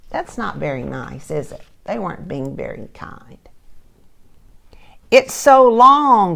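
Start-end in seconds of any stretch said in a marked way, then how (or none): 5.1-6.4 high, loud, slow teasing; mocking classmate. Classmate teases the mouse about her long name. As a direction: drag it out to mock her. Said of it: "said Joe".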